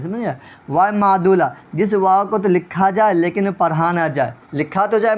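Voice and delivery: ordinary talk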